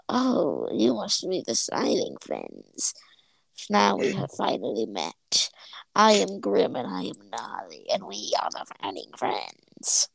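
slow, low, whispery villain voices; villainous rival introduction. Retold or imitated. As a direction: drag it out like a sinister cartoon rival.

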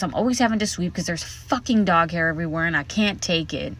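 ordinary talk, nothing marked

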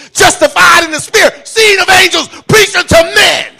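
high, shouted Pentecostal-style proclamation; full-force preaching. Real, not acted. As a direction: preach it at the top of your voice.

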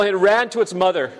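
normal talking voice

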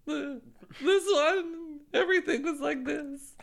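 high, loud, quavering scared voice; afraid earthworm voice. Shown as a demonstration. Mother showing the earthworm voice she reads, scared and high. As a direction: tremble through it like a frightened worm. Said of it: "the earthworm was just afraid".

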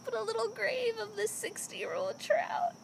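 high-pitched, hammed-up pleading; pretend dying wish. Co-host imagining burial beside a beloved old trout, melodramatic. Plays out outliving a pet trout.

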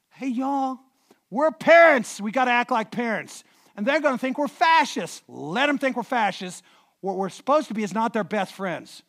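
full-force sermon exhortation; preaching exhortation. Real, not acted. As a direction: drive it home to the congregation.

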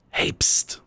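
hushed unvoiced pst from above; whisper. Fox in the tree whispering down to the chicken. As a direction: whisper to get attention. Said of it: "hört das Chicken auf einmal von oben so".

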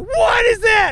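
high, loud startled cry; startled shout. Retold or imitated. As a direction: shout it like a spider just appeared. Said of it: "I was like".

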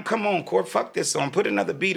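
mock-frustrated artist whining at the producer; exasperated protest. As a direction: beg him to drop the song.

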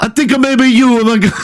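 high-pitched, hammed-up Italian; mock Italian accent. Fake therapist's reply drifting into another country, mock Italian accent. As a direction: slip into a badly faked Italian accent. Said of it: "I'm going Italian".

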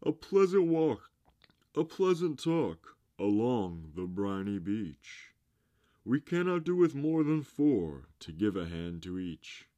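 high, loud, theatrical coaxing; beseeching walrus. A narrator's reading. Walrus coaxes oysters along the beach, loud beseeching. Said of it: "the walrus did beseech".

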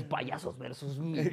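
grave y lento, voz de tráiler. Pretend. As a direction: anuncia como tráiler de cine. Tráiler de película. (narrador de tráiler lento)

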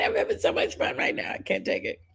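excited hushed whisper. Real, not acted. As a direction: whisper it, too thrilled to speak. Thrilled aside while watching clip.

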